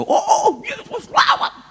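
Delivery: high, loud, panicky praying; acting out a jittery plea to Jesus; nervous overdone prayer. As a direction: pray far too high and loud, mock-frantic. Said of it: "Tangan saya gemetar semua".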